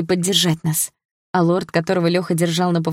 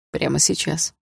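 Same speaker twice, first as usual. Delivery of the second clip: calm confirmation; 2 semitones lower, 3 dB quieter than usual. Acted. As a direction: confirm "Прямо сейчас" in a lowered man's voice. Dima confirms her question.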